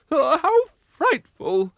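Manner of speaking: high, loud, slow fluttering cry. Acted. Missus Bumblebee dismayed at the failing engine, a high slow cry. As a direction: gasp it out like a fussy lady. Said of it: "cried Missus Bumblebee".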